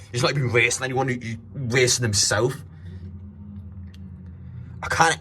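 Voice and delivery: normal talking voice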